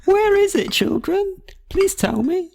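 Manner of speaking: put-on high children's presenter voice; Dora. Dora asks the children for help, high presenter voice. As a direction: ask the young viewers in a piping sing-song.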